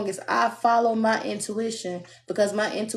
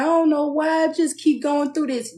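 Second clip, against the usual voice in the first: mock crying; 6 semitones higher, 3 dB louder than usual. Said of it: "be sitting up in the house crying".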